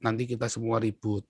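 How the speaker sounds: soft fatherly caution, imitated; hushed, patient word of advice; father's calm warning. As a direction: imitate a dad gently warning his child. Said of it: "ayah saya selalu bilang apa".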